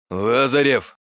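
blurted out. A narrator's reading. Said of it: "как на духу выпалил".

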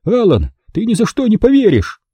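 high-pitched, excited news-bringing; excited cry. A narrator's reading. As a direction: call out high, bursting with news. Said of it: "закричал он".

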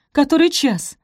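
high, loud urgent question; alarmed gasp. A narrator's reading. Sophie shakes awake to the time, alarmed demand. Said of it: "ахнула она".

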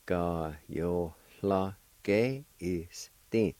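"Ka yo la ge is thin" spoken slowly, each syllable drawn out; slow demo. Shown as a demonstration. Teacher slowing down so learners catch "Ka yo la ge". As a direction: sound out "Ka yo la ge" syllable by syllable. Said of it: "It's pronounced like this".

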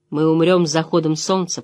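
ordinary delivery

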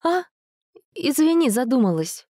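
high girlish voice, lightly played. Dasha voiced by male narrator.

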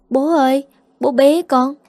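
put-on high, eager little girl's voice; small daughter reaching up to her father; happy little child. Little girl begging her father to pick her up, high and eager. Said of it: "bé My thấy bố về thì vui lắm".

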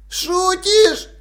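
a high squeak of disbelief. A narrator's reading. Frightened robber answering the watchman, high squeak. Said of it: "пискнул кто-то".

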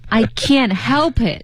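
slow, evenly spaced words; careful slow model reading; slow demo. Shown as a demonstration. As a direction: read it out slowly for learners. Co-host repeats the model slowly.

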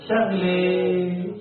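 Syrian "شغلة" with a high, long drawn-out "-eh" ending; exaggerated Syrian drawl; Syrian accent. Shown as a demonstration. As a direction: stretch the end of "شغلة" high, Syrian style. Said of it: "قلت لكم باللغة السوري هذا اسمها".

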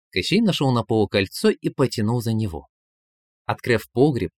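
ordinary delivery, nothing marked

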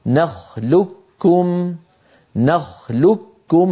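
"نخلقكم" very slowly as nakhlukkum; full assimilation. Shown as a demonstration. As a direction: merge q into a doubled k in "نخلقكم", no trace of q. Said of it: "يدغم القاف في الكاف".

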